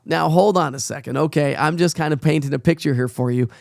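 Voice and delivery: ordinary delivery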